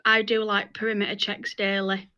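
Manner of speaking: normal talking voice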